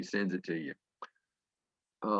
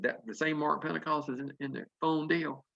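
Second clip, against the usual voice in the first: surprised disbelief; 5 semitones higher, 5 dB quieter than usual. Retold or imitated. Learns who the founder is.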